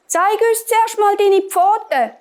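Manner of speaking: put-on high, demanding little kids; high-voiced goat children challenging the wolf; children calling out. A narrator's reading. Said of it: "haben die Kinder gerufen".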